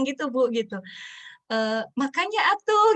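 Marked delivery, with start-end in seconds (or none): 2.0-2.9 mock know-it-all parent scolding, high and loud; pitched-up, louder mock I-told-you-so; omelan sok tahu. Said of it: "keluar lagi deh kalimat kita yang, eee, merasa jadi maha guru". (omelan ibu yang merasa maha guru)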